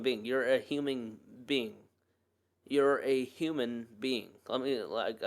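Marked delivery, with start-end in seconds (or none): 2.7-4.3 slow, deliberate repeat to let it sink in; slow emphasis. Real, not acted. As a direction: say each word slowly and deliberately. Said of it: "I just want that to settle in".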